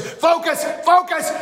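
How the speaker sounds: high, loud shout to focus; shouted command. Retold or imitated. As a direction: snap him into focus. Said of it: "I said".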